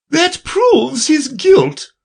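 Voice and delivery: shrill high queen; imperious queen, very high. Acted. Queen declares.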